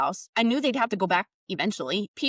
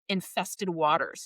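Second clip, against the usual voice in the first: normal talking voice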